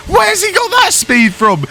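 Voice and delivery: surprised. Real, not acted. Rival suddenly fast.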